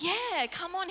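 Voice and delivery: high, eager urging; eager pastor. Retold or imitated. The pastor urging her on, high and eager. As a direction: hype it up like a cheerleader. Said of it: "he's like".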